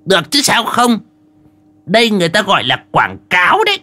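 high, cocky voice through a pout; dismissive high-voiced know-it-all; pouting scorn. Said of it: "Đoạn ông chề mồm".

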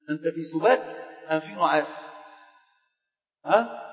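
soft, teasing nudge at a sleepy student; quiet teasing. Real, not acted.

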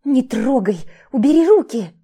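high, loud indignant protest; indignant shout. Acted. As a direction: yell it while struggling free. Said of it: "Возмущалась".

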